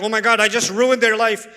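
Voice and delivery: mock-panicked self-blame after speaking truth. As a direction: blurt it like you've ruined everything. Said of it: "and you're like".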